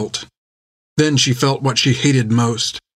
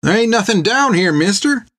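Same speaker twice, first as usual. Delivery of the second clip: put-on high, protesting young orphan voice; a teenaged digger talking back from the hole; a boy arguing up at the man; 9 semitones higher than usual. A narrator's reading. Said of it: "argued one of the boys".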